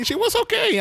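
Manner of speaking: lifts up high, casual; high offhand English. Real, not acted. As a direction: toss it off lightly in English. (tono despreocupado y agudo)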